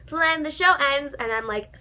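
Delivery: ordinary talk, nothing marked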